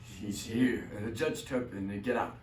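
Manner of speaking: low, hushed get out; hushed get out before the yell; muttered get out. Acted. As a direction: murmur it under your breath. Barber shooing someone away.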